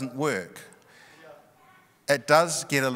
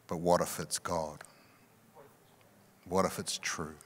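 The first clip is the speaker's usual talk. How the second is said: gentle, wise reply; 7 semitones lower, 8 dB quieter than usual. Retold or imitated. Pastor gently answering his crying friend, soft and low. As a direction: lower and softer, gently reassuring. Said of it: "in his great wisdom and love".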